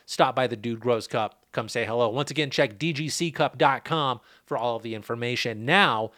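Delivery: ordinary talk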